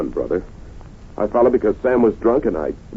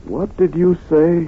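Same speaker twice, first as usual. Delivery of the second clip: shocked disbelief; 8 semitones higher, slower than usual. Acted. Husband shocked at the accusation.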